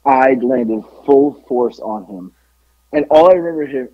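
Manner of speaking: ordinary delivery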